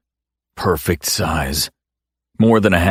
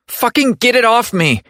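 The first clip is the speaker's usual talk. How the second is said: screaming. Acted. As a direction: scream it, frantic. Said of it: "she screams".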